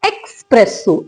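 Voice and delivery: एस्प्रेसो said slowly as eks-press-o; drawn-out K-S at the start of एस्प्रेसो; wrong X sound. Shown as a demonstration. Teacher showing what learners say, wrong X sound. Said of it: "आप इसको क्या बोलते हैं".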